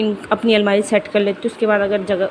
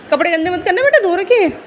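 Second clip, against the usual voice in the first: motherly caution; 8 semitones higher, 3 dB louder than usual. Real, not acted. Mother tells child not to dirty washed clothes, high-pitched caution. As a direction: gently warn a little one, voice lifted high.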